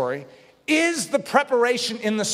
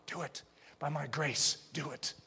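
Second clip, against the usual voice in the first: soft, low final charge; hushed command; 4 semitones lower, 13 dB quieter than usual. Retold or imitated. Jesus quietly urging the believer to act by grace. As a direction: drop to a near hush.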